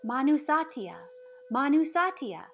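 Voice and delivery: loud, high call across the village. Acted. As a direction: cry the name out to her, pleading. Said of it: "the sick kept calling out to her".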